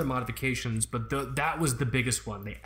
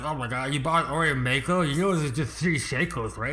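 mocking nerd voice; 3 dB louder than usual. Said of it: "It's just like".

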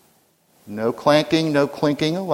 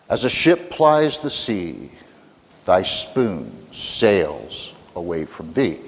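slow Southern North Carolina drawl, like a genteel aunt; North Carolina accent; 6 semitones lower, slower than usual. Retold or imitated. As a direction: recite the rhyme in a gentle North Carolina accent. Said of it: "with a North Carolina accent".

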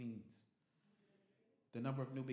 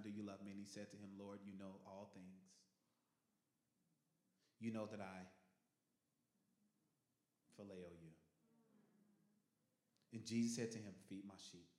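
soft grieving retelling; 5 semitones lower, 7 dB quieter than usual. Acted. Peter grieved, quiet retelling.

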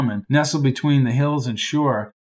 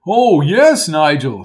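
a hint of a jovial old-timer calling him old boy; hearty old college man; 6 dB louder, slower than usual. Lark's character voice.

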